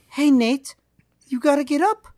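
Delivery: high mock British lady wake-up call; lightly put-on British lady voice; British woman. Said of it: "she did it like a British woman".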